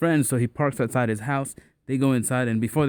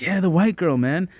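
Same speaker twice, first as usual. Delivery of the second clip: higher-pitched eager retold reply; excited confirmation; 6 semitones higher than usual. Telling his homie who the girl is, excitedly. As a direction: imitate yourself hyping up a pal.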